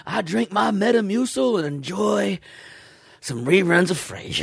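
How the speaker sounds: put-on lightly drawled old Southern voice; old Southerner. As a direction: play a folksy old Southern man. Continuing the old-man bit.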